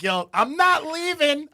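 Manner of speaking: high, slow, loud teasing mom voice; drawn-out, playful mom refusal. Retold or imitated. Mom teasing her son from the car, high and slow. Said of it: "and then Jules like".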